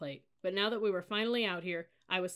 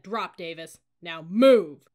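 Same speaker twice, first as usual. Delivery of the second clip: firm order exploding on "move"; commanding; 14 dB louder, much slower than usual. A narrator's reading. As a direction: build from stern order to shout. Cadet leader repeating order.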